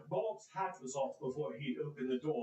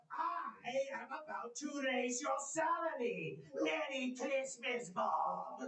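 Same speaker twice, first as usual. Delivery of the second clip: higher, slow and delighted; gleeful reveal; 7 semitones higher, slower than usual. Acted. Scrooge surprising Bob with a raise, gleeful and higher. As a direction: burst out the good news.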